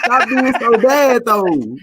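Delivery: high, loud playful scold; mock scolding. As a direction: tease while scolding. Friend voicing a fond scolding.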